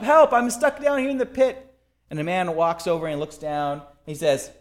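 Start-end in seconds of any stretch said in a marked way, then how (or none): 0.0-1.7 high call from a pit; acted yelling up. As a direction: shout upward for rescue, voice pitched high. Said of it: "he yells up".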